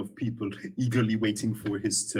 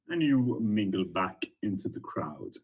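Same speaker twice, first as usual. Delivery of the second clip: usual speaking voice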